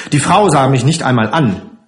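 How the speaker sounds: plain reading voice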